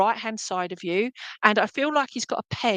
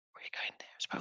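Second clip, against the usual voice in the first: soft, breathy whisper off to the side; whispered aside; 18 dB quieter, much faster than usual. Real, not acted. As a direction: whisper so the stream doesn't hear. Aside to someone off camera.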